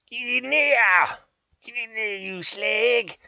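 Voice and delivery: high, loud, slow shout; loud high shout of a Cockney builder; mock Cockney yell. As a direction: holler it at the stubborn wood. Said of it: "I do the old".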